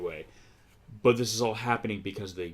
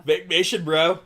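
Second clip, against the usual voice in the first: higher, louder hype-bro call; hyped bro shout; 7 semitones higher, 5 dB louder than usual. Pretend. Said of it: "That's for you, Chris".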